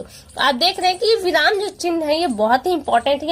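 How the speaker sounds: ordinary talk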